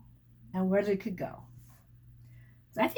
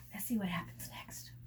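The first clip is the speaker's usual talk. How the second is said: whisper; 4 semitones lower, 12 dB quieter than usual. Real, not acted. Reader whispering to child.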